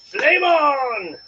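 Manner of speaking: high, loud, drawn-out catchphrase; playful battle cry. Pretend. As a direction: belt it out like a comic-book hero. Calls out catchphrase lighting the burner.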